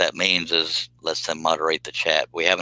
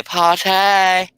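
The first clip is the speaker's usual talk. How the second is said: much higher and louder, gleeful; excited high call; 12 semitones higher, 6 dB louder than usual. Real, not acted. Host hoping David Jones joins, gleeful high call. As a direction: squeal it out with delight.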